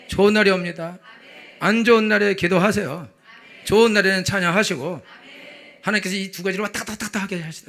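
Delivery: sermon climax, driving; fervent closing preaching. Real, not acted. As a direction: bless them with full force.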